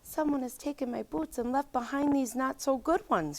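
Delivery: high, soft little cub wail; a lightly voiced small cub wailing; a hint of a high wailing cub; Baby Bear wail. A narrator's reading. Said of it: "she wailed".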